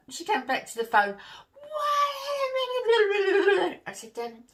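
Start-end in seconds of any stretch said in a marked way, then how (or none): 1.5-3.8 high, loud furious shout. Retold or imitated. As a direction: yell it in shock and anger. Mum shouting about ruined chair.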